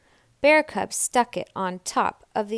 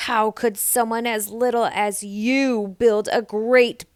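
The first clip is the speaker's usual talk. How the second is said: Big Lizzie; 10 dB louder than usual. A narrator's reading. Big Lizzie doubts little Nell built it. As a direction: scoff down at her. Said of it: "asked Big Lizzie".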